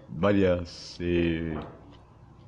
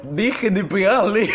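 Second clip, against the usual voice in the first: mock vice-principal; 10 semitones higher, 3 dB louder, slower than usual. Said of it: "veo a la vicedirectora atrás diciendo".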